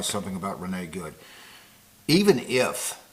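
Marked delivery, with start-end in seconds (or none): 2.1-3.0 "if" hit hard, higher and louder; stress on if. Shown as a demonstration. Commentator qualifying his claim to viewers, heavy stress on "if". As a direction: lean heavily on the word "if". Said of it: "I'm emphasizing very heavily the word if".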